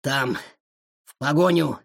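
faint, halting gasp of words; weak voice. A narrator's reading. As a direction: point the way feebly, pausing between words. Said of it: "слабым голосом сказал". (слабым голосом, с паузой)

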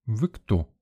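understated severity; stern query. A narrator's reading. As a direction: question a stranger sternly. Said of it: "строго уточнила дама".

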